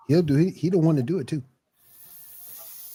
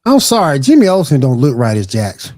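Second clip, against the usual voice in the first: loud outrage; 9 dB louder than usual. Real, not acted. Outraged at casting.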